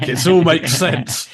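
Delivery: high, loud and slow, dripping with sarcasm; drawn out like a fake revelation; mock sarcasm. Sarcastic jab about online arguers.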